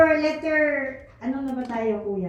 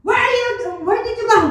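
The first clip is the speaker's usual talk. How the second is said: mother's demand; 8 semitones higher than usual. Retold or imitated. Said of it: "dumating siya".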